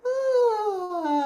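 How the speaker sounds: loud open yawning sigh, high. Shown as a demonstration. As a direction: release the breath with a big yawn. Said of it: "let it out with a yawn sigh".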